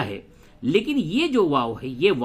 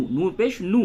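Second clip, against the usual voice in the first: نو with a brief u; short vowel; 3 semitones higher than usual. Shown as a demonstration. Teacher shows plain pesh read quickly, short نو. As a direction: spell it out, ending on a quick نو. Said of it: "صرف پیش ہے تو اسے جلدی سے ادا کریں".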